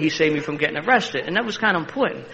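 ordinary talk, nothing marked

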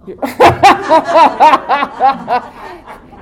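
loud, high burst of rolling laughter; hearty laugh. Real, not acted. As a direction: laugh long and out loud at a joke. Laughs at audience member's joke.

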